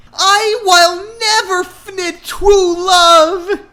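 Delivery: high, loud sobbing wail; hammed-up tearful wail; wailing cry. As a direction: cry it out in theatrical despair. Heroine despairs over love.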